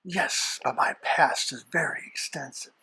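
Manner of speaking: sinister hushed rasp; hushed devil voice. Acted. Devil guest speaks hushed.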